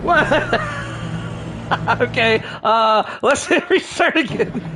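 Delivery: high, laughing disbelief. Real, not acted. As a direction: laugh through the words. Crashes car again, laughs.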